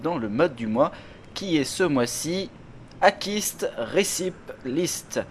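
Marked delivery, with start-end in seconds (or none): 3.0-5.2 slow, high, exaggerated English accent on Akist Recipe List; mock English accent. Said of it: "avec mon accent formidable". (accent anglais exagéré, lent et aigu)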